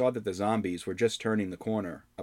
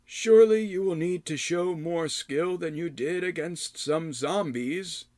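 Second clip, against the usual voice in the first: high, slow probing hermit; challenging old man; 8 semitones higher, slower than usual. Acted. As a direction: test him like a stern old man. Rydoth challenges.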